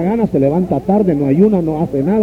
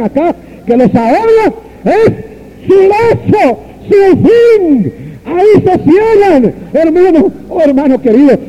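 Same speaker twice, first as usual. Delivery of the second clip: preaching at full force; 6 semitones higher than usual. Real, not acted. Preacher warning dear brothers about temporal riches, high fervent preaching. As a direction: cry it out over the hall.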